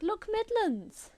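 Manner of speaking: high, loud, slow announcer voice; mock TV announcer. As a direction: announce it like a news jingle. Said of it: "every six o'clock it's like".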